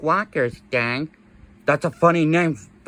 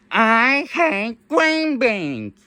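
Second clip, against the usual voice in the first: slow drawn-out squawky bird voice; slow parrot voice; 4 semitones higher, much slower than usual. Pretend. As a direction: stretch each word like a bird. Parrot voice said slowly.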